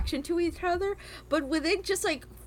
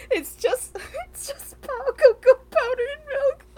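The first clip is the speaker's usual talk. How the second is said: much higher and louder, squealing through laughter; laughing squeal; 12 semitones higher, 6 dB louder than usual. Real, not acted. Host ranting to co-hosts, laughing and squealing. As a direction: squeal it out, cracking up. Peak of cocoa rant.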